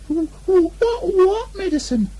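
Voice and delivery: very high, slow stammering squeak; a tiny pig dreading the medicine; frightened stammer. Acted. Piglet scared stammer.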